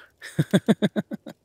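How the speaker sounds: high, loud burst of giggling laughter; big laugh. Acted. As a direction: burst out laughing at your own joke. Laughing at own joke.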